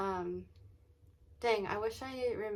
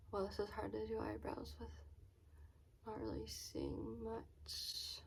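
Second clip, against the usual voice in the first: whispering; 11 dB quieter than usual. Real, not acted. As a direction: murmur it under your breath while concentrating. Doing eyebrows, talking to herself.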